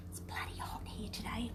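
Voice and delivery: whispered aside, barely voiced; confiding whisper. Real, not acted. Host confides a mild swear to viewers, whispering. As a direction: whisper it like a naughty secret.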